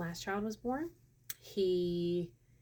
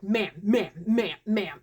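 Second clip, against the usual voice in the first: loud, repeated retold calls; agitated guards; 7 dB louder, slower than usual. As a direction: call out insistently as agitated security. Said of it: "the guys are real agitated".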